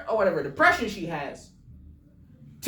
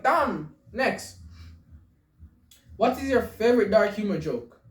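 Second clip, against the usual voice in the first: usual speaking voice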